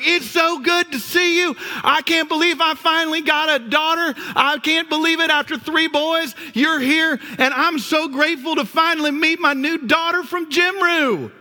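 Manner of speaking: high, excited Southern dad voice, lightly done; imitating dad. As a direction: gush like a thrilled father meeting his new daughter. Dad greeting daughter-in-law warmly.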